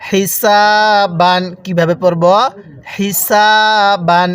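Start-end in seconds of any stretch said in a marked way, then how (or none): 0.1-1.4 slow "হিসাবান" with a long held সা; drawn-out vowel. Shown as a demonstration. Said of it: "এই টানের নিয়মগুলো". 2.9-4.3 slow "হিসাবান" with a long held সা; drawn-out vowel. Shown as a demonstration. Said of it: "এই টানের নিয়মগুলো".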